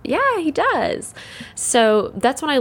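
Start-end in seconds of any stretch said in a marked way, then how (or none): 0.0-1.1 slow, higher voiced neighbor; a lightly acted neighbor confirming it; neighbor's reply. Neighbor confirms dad's accent, slow higher mock voice. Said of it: "she was like".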